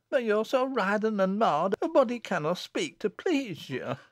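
high tearful complaint; sobbing. A narrator's reading. Susan complaining of Jennet's temper, sobbing. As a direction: protest through mild sobs. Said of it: "sobbed Susan".

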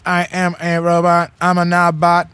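mock robot monotone; level, mechanical delivery as a joke. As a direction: play a robot, evenly spaced syllables. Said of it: "You sound like a fucking robot".

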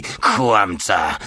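harsh, venomous hiss; hissed accusation. A narrator's reading. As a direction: spit the accusation out. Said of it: "syczał stary".

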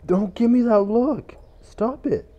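high, loud yell at a glaring customer; mock angry shout. Real, not acted. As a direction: snap at him to quit it. Yelling at game customer.

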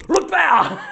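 loud, high yelp; hammed-up shriek, high and loud; mock scream. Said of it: "I screamed".

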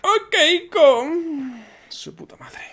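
exasperated. Real, not acted. Caught again, frustrated.

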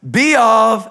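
calling out. Shown as a demonstration. Pastor prompting the congregation to repeat, loud and high. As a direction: lead the crowd in the phrase, loud and high. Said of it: "everybody say it".

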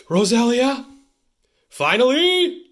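loud, high celebratory yell; excited shout. Real, not acted. Host revealing last pack cards, excited shout. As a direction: belt it out, thrilled.

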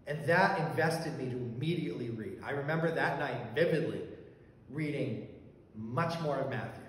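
usual speaking voice

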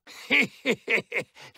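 high wicked cackle; evil cackle. Acted. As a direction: cackle with cruel delight. Villain laughs between lines.

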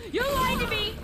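high, loud yell at chat; panicked accusation. Real, not acted. Streamer yelling at chat who tricked him, panicked. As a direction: blame chat while running for your life. Shouting at chat while fleeing.

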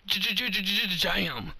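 high, rattling stutter into the word; rapid-fire stammered dunk call; stuttered hype call. Real, not acted. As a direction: stutter the first sound like a hype announcer. Celebrating a dunk.

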